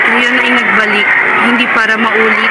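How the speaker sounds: reflective closing narration; story narration. A narrator's reading. (pagsasalaysay na pagninilay)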